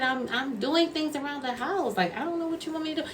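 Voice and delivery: high-pitched pleading protest; exasperated. Retold or imitated. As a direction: retell a helpless, exasperated reply way up high. Retold exasperated reply to crying client.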